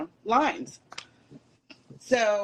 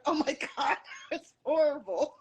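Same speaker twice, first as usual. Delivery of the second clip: laughing; 5 semitones higher than usual. Retold or imitated. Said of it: "He's like".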